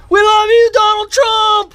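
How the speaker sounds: very high, loud, drawn-out shout; thrilled holler, stretching the words; real fan yell. Real, not acted. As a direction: yell it out to someone across the street. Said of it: "[name] and I yelled".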